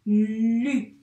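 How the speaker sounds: "Lu" with a long, loud l into u; emphatic drawn-out "Lu"; sounds blended slowly. Shown as a demonstration. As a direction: stretch "Lu" for dictation. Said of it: "il y a deux sons".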